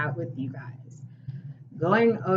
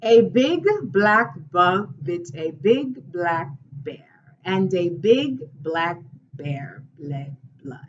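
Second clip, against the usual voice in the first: slow crisp enunciation drill; slow tongue twisters; slower than usual. Shown as a demonstration. Teacher demonstrating tongue twisters to students, slow and crisp. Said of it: "tongue twisters that I'd like to share".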